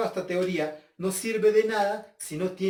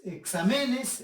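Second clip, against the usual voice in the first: "exámenes" misstressed as exa-ME-nes; higher-pitched "exámenes" with stress moved to "me"; wrong stress on ME; 4 semitones higher, slower than usual. Shown as a demonstration. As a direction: punch the third syllable of "exámenes". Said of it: "no, exámenes".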